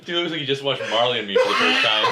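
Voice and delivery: loud laughter breaking through the words; mocking friend's teary face, laughing loud. Real, not acted. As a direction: crack up while teasing him.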